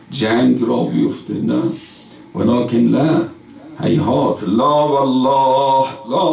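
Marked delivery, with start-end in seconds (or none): none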